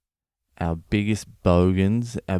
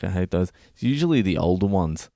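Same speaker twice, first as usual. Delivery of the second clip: ordinary talk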